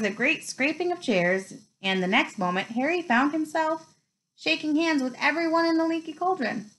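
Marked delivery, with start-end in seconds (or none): none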